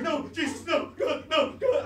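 high-pitched frantic pleading; hammed-up panic attack, squealing; [name], panicking. Stag facing blacked out goggles, hammed-up panic. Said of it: "having a fucking panic attack".

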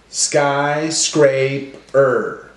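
"Skyscraper" split slowly into three clapped beats; clapped syllables. Shown as a demonstration. Teacher clapping out "Skyscraper" syllables for class. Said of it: "clap out our syllables".

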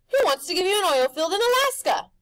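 loud, high showman pitch; pushy grinning tempter; Rictus's brash voice. A narrator's reading. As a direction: sell it like a carnival barker. Rictus relaying phone call.

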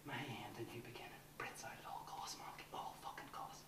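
quick, tight whisper; hushed vow. Acted. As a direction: whisper the oath fiercely.

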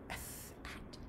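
unvoiced whisper, sounding it out. Zero sounding out the word to himself, whispered. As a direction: breathe the letters with no voice. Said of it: "Zero whispered".

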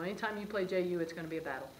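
ordinary delivery

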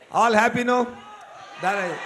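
ordinary delivery

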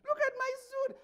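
high mocking pitch on look. Real, not acted. Host mocking his own suit, high-pitched. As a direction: pitch it up high to mock himself.

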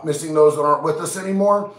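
normal talking voice

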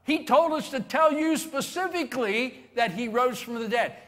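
high, loud, fast joyful teasing; laughing reassurance. Acted. Women telling Peter not to worry, fast joyful teasing. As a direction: tease him with delight. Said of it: "laughing and saying".

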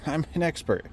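high, laughing ironic brag; laughing mock boast. Self-mocking boast about expertise.

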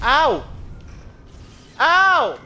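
loud high ow; yelping as the hits land; yelp of pain. Real, not acted. Hit by enemies in game.

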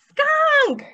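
high, loud shout; big one-word reveal, loud and high; excited call-out. Pretend. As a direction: call out the animal's name for the children. Announces next animal to children.

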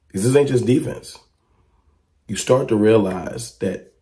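ordinary talk, nothing marked

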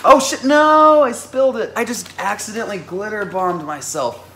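high, loud panicked yelp; sudden real alarm, loud and high; startled shriek. Real, not acted. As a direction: shriek as the glitter spills. Glitter spills on her.

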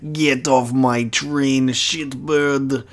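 put-on lightly done Russian accent, slow; a hint of a bad Russian accent, drawn out. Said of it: "I have also have terrible Russian accent".

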